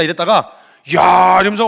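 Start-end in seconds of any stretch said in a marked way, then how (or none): 1.0-1.4 loud, acted cry of praise; admiring exclamation. Jesus praising Peter's confession, loudly impressed. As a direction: burst out as if impressed. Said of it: "이러면서 칭찬을 받아요".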